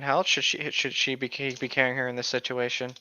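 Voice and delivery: ordinary talk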